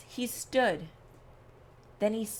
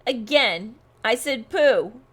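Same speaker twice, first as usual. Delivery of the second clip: the repeat pushed louder, "pooh" rising with scorn; louder scornful pooh; 8 dB louder than usual. A narrator's reading. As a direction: say it again, louder and more dismissive. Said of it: "the bear snorted".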